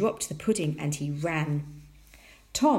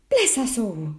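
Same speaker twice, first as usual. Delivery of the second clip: higher, louder startled exclamation; startled mother; 5 semitones higher, 7 dB louder than usual. A narrator's reading. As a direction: exclaim in sudden astonishment. Mother startled by jumping pudding.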